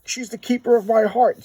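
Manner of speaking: loud, high mock declaration of love; hammed-up lovestruck villain; ardent declaration. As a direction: proclaim it like a melodramatic suitor. Thanos declares love for Death.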